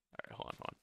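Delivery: whispered under breath. Real, not acted. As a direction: mutter it almost unvoiced. Muttering while concentrating.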